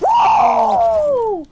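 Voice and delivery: big high shout of joy; victory whoop. Real, not acted. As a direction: yell out the win at full voice. Celebrating a won gunfight.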